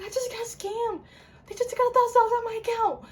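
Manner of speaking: high, loud and fast panic; frantic high yell of panic; panicked. Retold or imitated. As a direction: blurt it out in a frantic rush. Panicked call telling mom.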